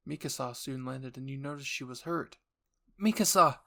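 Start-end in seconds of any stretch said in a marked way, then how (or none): 3.0-3.6 high, loud urgent call; alarmed cry. Acted. Alarmed cry on seeing Mikasa hurt.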